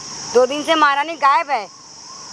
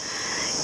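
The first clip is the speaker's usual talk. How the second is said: mocking laugh; 11 dB quieter than usual. Mocking laugh at her threat.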